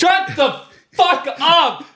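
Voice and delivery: very high, loud, drawn-out outburst; exasperated scream. Real, not acted. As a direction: explode at your co-host, slow and screaming.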